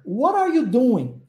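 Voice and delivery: what are you doing said slowly with linked what are; slow clear teacher model; slow model phrase. Shown as a demonstration.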